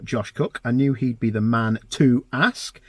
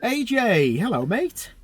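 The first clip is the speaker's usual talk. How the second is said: bursting hello, much higher and bright with energy; enthusiastic greeting; 12 semitones higher, slower than usual. Real, not acted. Host greeting his guest over the phone, overexcited. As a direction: greet your mate like you're thrilled he picked up. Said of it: "that was a bit enthusiastic".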